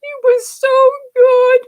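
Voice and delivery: high, slow tearful quaver; choked up with tears. Retold or imitated. Mother crying at the party, tearful and high. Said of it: "she starts to cry".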